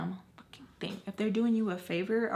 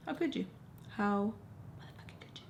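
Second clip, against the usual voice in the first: muttered disbelief; 2 semitones higher, 7 dB quieter, faster than usual. Retold or imitated. Muttered exasperation, barely voiced end.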